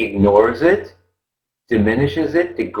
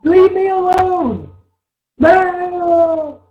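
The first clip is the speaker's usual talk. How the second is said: put-on childish shout; much slower than usual.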